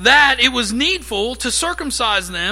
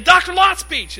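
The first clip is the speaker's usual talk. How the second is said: raised, louder call to the professor; 6 semitones higher, 3 dB louder than usual. Retold or imitated. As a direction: call his name out, fired up. Angry student calling out in class.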